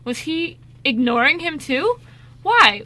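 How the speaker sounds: normal talking voice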